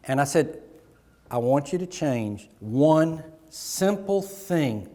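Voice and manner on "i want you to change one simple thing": slow emphatic advice. Retold or imitated.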